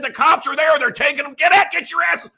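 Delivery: high, loud rallying yell; urgent yelling. Retold or imitated. Retelling his call to rouse tired Italians, urgent yelling. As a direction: re-enact a frantic call to get out there.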